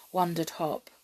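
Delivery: usual speaking voice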